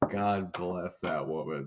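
slow, solemn blessing; slow reverent quote. Retold or imitated. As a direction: say it slowly like a grace. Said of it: "He's like".

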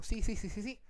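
quick, soft run of yeses; hushed agreement. Retold or imitated. Man brushing off a remark about the pipes, hushed agreement. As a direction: agree softly, over and over. Hurried quiet agreement in story.

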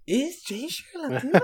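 high, laughing mock surprise; echoing a cohost's joke with a laugh. Real, not acted. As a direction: laugh it back at them.

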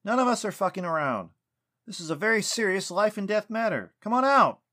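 loud, high furious shouting, rising to a roar; angry yelling. A narrator's reading. Lucas yelling at the tree, furious. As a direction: shout the last words hardest. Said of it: "Lucas yelled".

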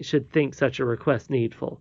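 plain reading voice

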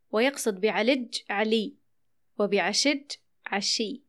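ordinary talk

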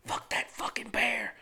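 hushed slow whisper; whispered slowly at the movies; whispered curse. Retold or imitated. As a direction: hiss it under your breath in a theater. Muttered aside in theater.

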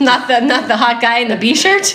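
giggling through the words; laughing while talking. Real, not acted. Giggling about the hot guy.

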